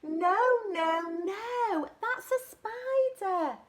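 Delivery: eager correcting; excited correction. Acted. Little monkey telling the butterfly no, that's a snake, high and excited.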